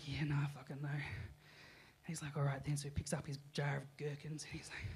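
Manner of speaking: unvoiced soft whisper; hushed sheepish whisper. [name] whispering back, embarrassed at the checkout.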